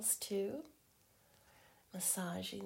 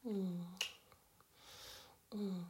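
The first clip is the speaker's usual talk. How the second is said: ordinary talk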